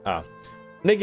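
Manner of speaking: normal talking voice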